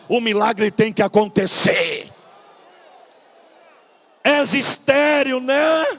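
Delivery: loud, high, slow hammered preacher's cry; full-force preaching. Acted. Pastor proclaiming miracles to the congregation, slow loud preaching. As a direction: drag each word out like a revival preacher.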